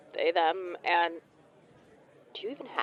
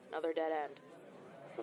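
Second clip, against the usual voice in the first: much softer, slow defeated mutter; dejected mutter; 13 dB quieter, slower than usual. Acted. Agent refuses details, caller mutters dejectedly. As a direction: let it sink, slow and soft.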